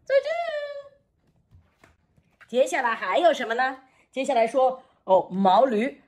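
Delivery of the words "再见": puppet voice. Acted. Puppet Frankie says goodbye.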